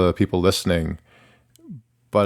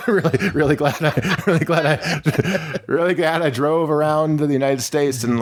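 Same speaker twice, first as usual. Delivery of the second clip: higher-pitched, laughing through the words; 7 semitones higher than usual. Real, not acted. Musician laughing about a demoralizing tour, laughing. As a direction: keep cracking up and restarting the line.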